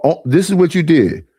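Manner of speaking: ordinary talk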